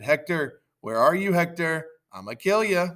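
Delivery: loud, high calling threat; taunting shout. Acted. Achilles hunts for Hector, high threatening shout. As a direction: call out the foe, acted. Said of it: "soon Achilles shouted".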